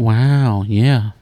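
lightly imitating Owen Wilson; Owen Wilson wow. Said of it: "I just did a little Owen Wilson wow".

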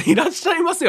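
high, sing-song clerk welcome. Retold or imitated. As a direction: chirp it like a store clerk. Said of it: "は万能の言葉じゃねえぞ". (高く明るい店員の「いらっしゃいませ」)

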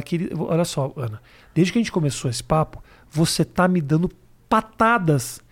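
low, measured, patient appeal; calm reasoning. Retold or imitated. Comedian replaying his appeal to a snappy presenter, calm and measured.

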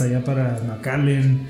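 normal talking voice

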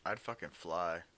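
stoned and drowsy. Real, not acted. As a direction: sound really baked. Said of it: "I'm just really baked right now".

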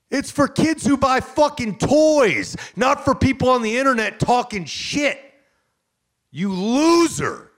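high, loud shouting tirade; full-voiced promo-style outburst; furious rant. Real, not acted. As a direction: yell it at the critics, stretching key words. Angry rant at internet critics.

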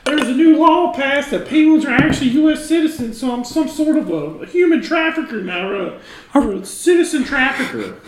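high, loud, put-on penguin dealer voice; sheepish penguin smuggler in a squeaky put-on voice; high character voice. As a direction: play the shady penguin salesman way up high. Improv character Ricky, high voice.

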